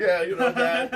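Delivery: laughing yeah. Real, not acted. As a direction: talk through a laugh. Laugh heard, high loud far.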